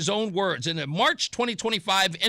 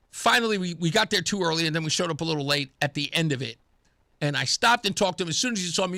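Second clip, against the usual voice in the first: ordinary talk, nothing marked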